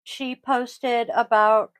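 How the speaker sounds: ordinary delivery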